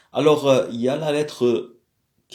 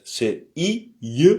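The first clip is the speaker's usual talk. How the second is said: ordinary delivery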